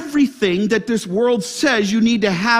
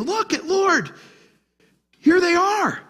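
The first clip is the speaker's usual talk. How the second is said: delighted; 10 semitones higher than usual. Retold or imitated. Paul quoted, rejoicing.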